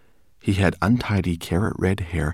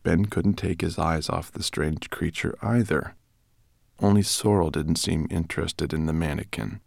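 ordinary delivery, nothing marked

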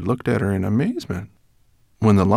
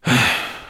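heavy, weary sigh; 4 semitones higher than usual. A narrator's reading. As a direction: breathe out in defeat. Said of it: "He sighed".

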